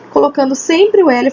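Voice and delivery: ordinary delivery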